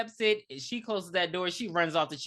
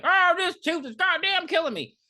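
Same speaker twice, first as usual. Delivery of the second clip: higher, louder whiny complaint; patient in pain; 12 semitones higher, 7 dB louder than usual. Retold or imitated. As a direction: moan about the tooth, higher and louder. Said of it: "he's just like".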